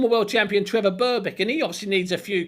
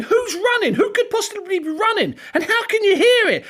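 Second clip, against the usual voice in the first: mock bewilderment; 10 semitones higher than usual. Retelling the crowd's puzzled wonder.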